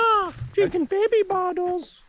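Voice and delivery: drawn-out, very high mock voice; mock them slowly, way up high; high-pitched mocking. Mocking the baby bottle jibe.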